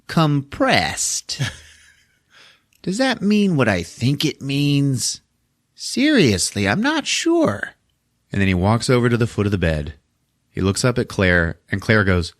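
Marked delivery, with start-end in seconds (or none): 0.1-7.7 high, put-on killer doll voice; mock-pondering doll, pitched up and drawn out; Chucky. Killer doll toying with Claire over the bottle, put-on high voice. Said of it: "and he goes".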